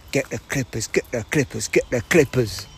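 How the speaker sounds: low, insistent repeated mantra; slightly hammed-up fixation, slowing at the end; obsessed barber's thought. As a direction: chant it like a one-track-minded barber, lightly voiced. Voicing barbers' obsessed thought over and over.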